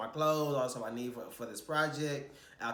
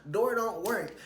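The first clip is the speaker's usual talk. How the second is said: annoyed complaint; 7 semitones higher, 3 dB louder than usual. Retold or imitated. Frantic at a stuck door.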